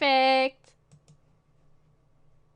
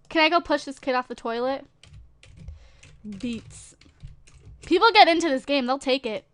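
normal talking voice

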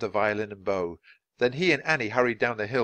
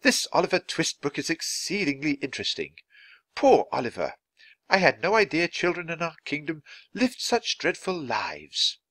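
higher-pitched queen, moved by a book; concerned queen; 7 semitones higher than usual. A narrator's reading. Queen to her lady in waiting, concerned.

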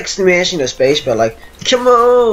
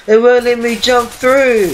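normal talking voice

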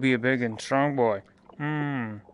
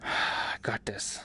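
nervous whisper; 3 semitones lower, slower than usual. Real, not acted. Bracing self in horror game.

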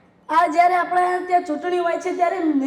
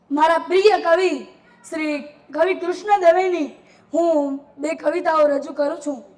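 usual speaking voice

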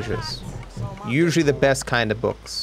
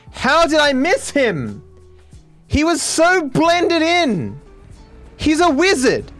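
very high, drawn-out cries of disbelief; high-pitched astonished protest; incredulous outcry; 5 dB louder, slower than usual. Real, not acted. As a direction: wail in loud, slow amazement. Misses enemy, exclaims in disbelief.

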